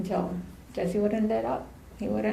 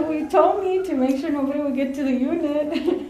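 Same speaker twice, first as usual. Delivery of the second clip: higher, louder self-defense through laughter; laughing mock protest; 6 semitones higher, 5 dB louder than usual. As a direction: protest while cracking up, retelling it. Defends self to scolding partner, laughing.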